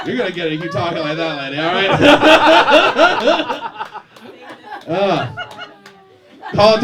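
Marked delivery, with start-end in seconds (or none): 1.9-4.8 high, loud real laughter; loud burst of laughter. Real, not acted. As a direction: crack up at the heckle. Comic laughs at heckler.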